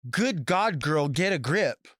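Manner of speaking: imitating catchphrase fans. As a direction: parody people repeating drag phrases. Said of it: "Or they like to say".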